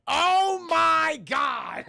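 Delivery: very drawn-out, high, loud cry; ecstatic exclamation. Real, not acted. Streamer thrilled as episode ends, ecstatic shout. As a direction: shout it slowly in sheer amazement.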